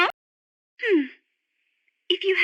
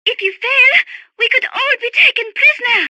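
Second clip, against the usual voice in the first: high, raised, anxious voice; urgent warning; 7 semitones higher, 4 dB louder than usual. Acted. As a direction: warn with rising alarm.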